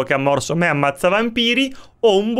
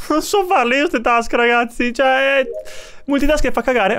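laughing complaint; 8 semitones higher than usual. Real, not acted. Laughs while complaining about task.

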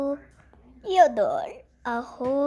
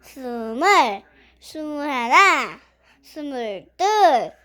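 doing push ups; 6 dB louder than usual. Real, not acted. Counting reps during a workout.

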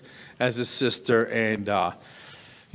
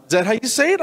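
mock clerk; 10 semitones higher, 4 dB louder, faster than usual. Rental clerk voiced high.

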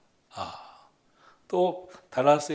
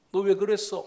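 higher, louder accusing question; mock interrogation; 7 semitones higher, 5 dB louder than usual. Pharaoh confronts the midwives, retold as a mock interrogation. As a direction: demand an answer as an angry king.

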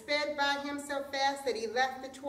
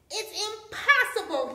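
high, loud cry of disbelief; storyteller's stretched-out scream; outraged scream; 10 semitones higher, 8 dB louder than usual. A narrator's reading. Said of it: "he screamed".

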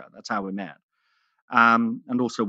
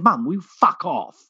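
exasperated snap; 5 semitones higher, 4 dB louder than usual. Retold or imitated. Snapping at mum in story.

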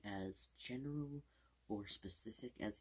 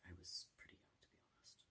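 whispering; 10 dB quieter, much faster than usual. Acted. Whispered ASMR aside.

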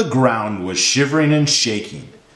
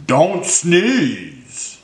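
slow hippo plea, less high; lightly voiced hippo begging; drawn-out plea with a falling end; pleading hippo; 5 semitones higher than usual. A narrator's reading. Hippo begs elephant not to sneeze.

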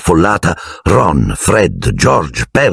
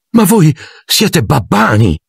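much higher, slower, delighted cry; excited exclaim; 10 semitones higher, slower than usual. A narrator's reading. Mr Weasley greeting Hermione's parents, high and thrilled. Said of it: "esclamò eccitato il signor Weasley".

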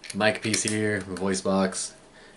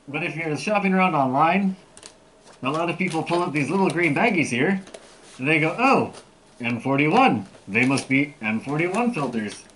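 muffled behind mask voicemitter; speaking inside full respirator; through a gas mask; 5 semitones higher than usual. Real, not acted. Seen 5: Full-face mask worn over mouth in every frame shown.

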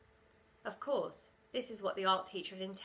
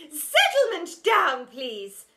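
high, loud, slow call; teacher shout; 5 semitones higher, 13 dB louder, much slower than usual. A narrator's reading. Teacher shouting at the hall, loud and high. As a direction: shout for order. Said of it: "shouted the teacher".

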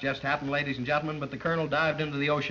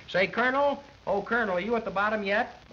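calling down to the diver; 7 semitones higher than usual. Pretend. Announcer calling down to the colonel on the ocean bed, high hailing call. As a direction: shout the question down to a diver far below.

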